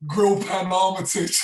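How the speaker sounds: says the nickname through a high, loud laugh; cracking up at his own nickname. Real, not acted. Laughing as he tells the podcast hosts his old nickname.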